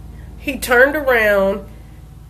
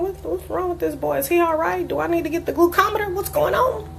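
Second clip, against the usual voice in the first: worried inner thoughts; 8 semitones higher, 6 dB quieter, faster than usual. Retold or imitated. Mother worried watching sleeping son.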